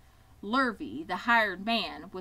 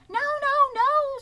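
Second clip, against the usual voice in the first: very high, loud goose protest; alarmed goose; 11 dB louder, slower than usual. A narrator's reading. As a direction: shriek a warning as a frantic goose. Goose warning Wilbur off the pail.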